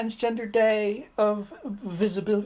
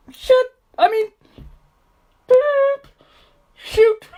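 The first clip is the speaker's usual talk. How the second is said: sudden much higher, louder yelp of surprise; startled slip; 6 dB louder than usual. Real, not acted. Swearing by accident in a kids' video, much higher and louder, flustered. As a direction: catch yourself after blurting a swear.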